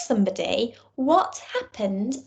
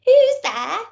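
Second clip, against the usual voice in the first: put-on high, louder old granny call; grandma's voice; 10 semitones higher, 7 dB louder than usual. Grandma answers the door.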